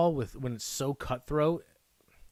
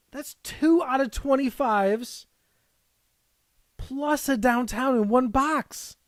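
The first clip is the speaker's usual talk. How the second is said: high, louder astonished announcing; stunned by the luck; higher, thrilled tally; amazed excitement; 9 semitones higher, 4 dB louder than usual. Real, not acted. Amazed at two rare pulls.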